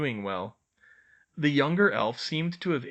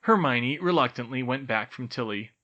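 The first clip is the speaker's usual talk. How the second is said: ordinary delivery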